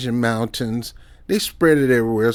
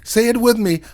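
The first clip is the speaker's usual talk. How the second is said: ordinary talk, nothing marked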